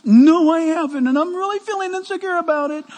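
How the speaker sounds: a hint of an anxious believer answering back; mock insecure believer.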